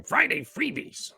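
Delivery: high-pitched, drawn-out mimicry of a jingle voice; slow, squeaky-high announcer imitation; producer's voiceover impression. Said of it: "our producer's voice going".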